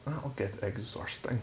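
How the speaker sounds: soft, slow, botched Scottish accent; attempted Scottish accent. Shown as a demonstration. Asked to do Storytime in Scottish, quiet fumbled Scottish accent. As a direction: try a quiet Scottish drawl and miss. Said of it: "I did that so wrong".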